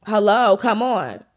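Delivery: loud, exasperated mock call for attention; impatient calling out. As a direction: imitate a date trying to get through to someone. Ignored date calling out to her.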